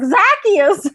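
high loud shout of Zacchaeus; excited call. Pretend. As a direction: cry out Zacchaeus. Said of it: "I'm like".

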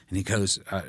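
ordinary talk, nothing marked

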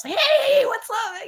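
high, bright hammed-up greeting; excited greeting. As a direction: act out the cool guys saying hi. MTV people welcoming them.

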